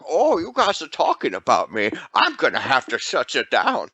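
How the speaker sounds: high nasal mumbling goofball voice; Adam Sandler impression. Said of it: "He's like".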